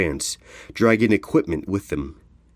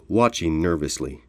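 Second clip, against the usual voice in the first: plain reading voice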